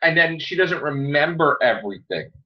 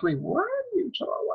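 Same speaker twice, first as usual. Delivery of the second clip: much higher, much softer bewildered squeak; baffled reaction; 13 semitones higher, 10 dB quieter than usual. Retold or imitated. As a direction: sound completely lost by what she said. Reacting to the Doctor's line.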